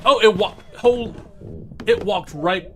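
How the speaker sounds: loud panicked yelling; panicked, ghost right behind; being chased. Real, not acted. Ghost chasing him in game.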